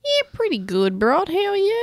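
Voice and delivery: playful Ocker greeting, lifting high at the end; broad Ocker, rising right up on the question; Ocker accent. Pretend. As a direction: put on a broad Ocker Aussie hello. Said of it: "Were you going Ocker?".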